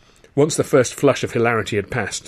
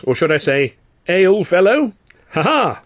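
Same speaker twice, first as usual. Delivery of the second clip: loud, much higher hearty hail; cried-out greeting; 8 semitones higher, 5 dB louder than usual. A narrator's reading. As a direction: call it across the pub, big and booming. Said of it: "he cried out".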